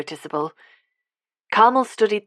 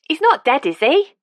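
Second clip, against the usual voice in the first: put-on lively elderly Londoner, higher and louder; Cockney old woman; 9 semitones higher, 6 dB louder than usual. A narrator's reading. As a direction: voice a cheerful old Cockney lady. Carmel voiced in the story.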